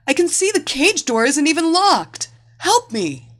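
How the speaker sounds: breathless, desperate plea; struggling to speak. A narrator's reading. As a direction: beg for help while fighting for air. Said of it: "the woman struggled to speak".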